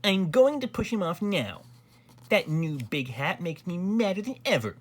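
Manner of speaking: high-pitched, defiant noble; talking back. A narrator's reading. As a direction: defy the king in a heated high voice. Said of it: "talked back to the king".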